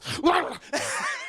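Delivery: loud high garbled yelp into laughter; startled waking noise. Retold or imitated. Imitating Happy jolted awake on camera, loud yelp. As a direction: blurt a wordless yell and crack up.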